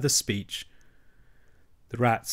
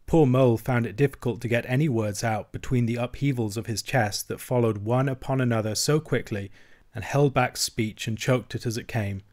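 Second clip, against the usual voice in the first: usual speaking voice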